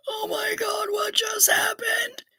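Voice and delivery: high whispered panic; horrified whisper. Retold or imitated. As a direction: whisper it, mortified. Friend's mortified reaction.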